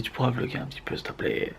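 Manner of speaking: whispering. Retold or imitated. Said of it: "elle m'a dit".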